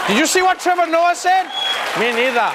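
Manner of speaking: very high, put-on excited voice; high-pitched mock outburst.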